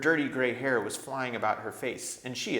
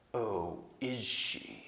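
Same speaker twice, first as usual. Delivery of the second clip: gray terrible voice; 4 dB quieter, much slower than usual. A narrator's reading. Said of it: "That gray and terrible voice".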